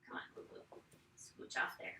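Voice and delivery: soft whisper, coaxing the puppy off the mat; hushed coaxing. Real, not acted. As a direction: whisper gently to shoo the dog along.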